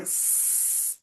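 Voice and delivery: long, steady hissed S sound; snake-like "s", held long; prolonged S. Shown as a demonstration. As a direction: draw out "s" as a continuous sss. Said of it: "você pode prolongar o som".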